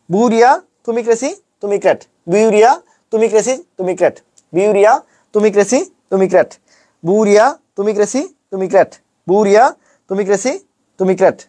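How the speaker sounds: sing-song list, বুরিয়া and crazy rising, ক্র্যাট falling; memorisation drill. Shown as a demonstration. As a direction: chant বুরিয়া, তুমি crazy with a lift and pause after each. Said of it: "আমার সাথে সাথে একদম মুখস্থ".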